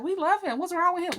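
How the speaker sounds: high, fawning mock voice of admiring people; starry-eyed fan voice; gushing admirers. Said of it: "people be like".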